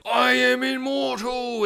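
high, slow, sustained madman cry; mock crazed proclamation. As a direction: stretch it out like a hammed-up cult maniac. Crazed dad declares himself immortal.

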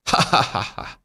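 breathy evil cackle; villain laugh. Acted. Fire laughs after boasting.

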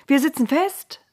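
high, loud, gleeful cry; jubilant. Acted. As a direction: cheer it like you've just been freed. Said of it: "frohlockten Noahs Söhne".

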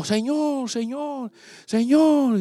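high, held-back calling out; imitated blind man's cry. Pastor acting the blind man crying to the Lord, high mock cry. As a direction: mimic a distant cry for help, pitched high. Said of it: "gritó, gritó, gritó".

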